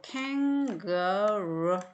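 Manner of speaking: 캥거루 stretched slowly, beat by beat; teacher splitting kangaroo slowly; slow syllable split. Shown as a demonstration. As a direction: draw out each syllable of 캥거루. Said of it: "몸이 어디 있는지 보세요".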